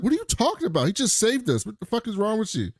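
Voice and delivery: high-pitched mock disbelief; incredulous objection. Crewmate defending the one who saved them, incredulous high voice.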